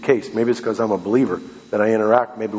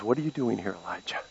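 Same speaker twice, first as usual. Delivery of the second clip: much softer, gentle and thin; barely above a breath; still small voice; 11 dB quieter, faster than usual. Retold or imitated. God asking Elijah in a still small voice. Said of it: "that still small voice".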